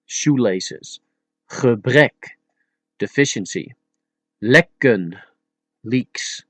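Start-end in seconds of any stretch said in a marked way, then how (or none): 1.5-2.4 Gebrek with uh, then short eh; Dutch E sounds. Shown as a demonstration. Teacher giving an example, Gebrek with uh then eh. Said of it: "listen closely at the pronunciation". 4.4-5.2 Lekken with short eh, then uh; Dutch E sounds. Shown as a demonstration. Teacher giving an example, Lekken with eh then uh. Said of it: "listen closely at the pronunciation".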